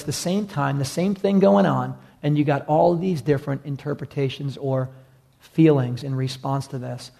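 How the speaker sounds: normal talking voice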